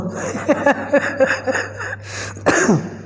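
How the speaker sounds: real run of short coughs; helpless burst of coughing; coughing fit. Real, not acted. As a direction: cough repeatedly, ending in a throat clear. Coughing fit while ill with cold.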